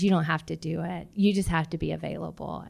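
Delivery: ordinary talk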